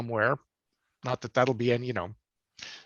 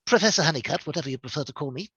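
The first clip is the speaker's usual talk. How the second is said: lightly voiced fussy robot; a touch of prim droid politeness; Fugitoid; faster than usual. Actor showing a fan his character, lightly fussy robot voice. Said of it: "I will always be able to do C three, uh, the, the Fugitoid".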